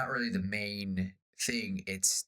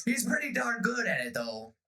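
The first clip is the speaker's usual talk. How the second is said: proud pirate; 10 semitones higher than usual. Retold or imitated. Pirate praises the dentist.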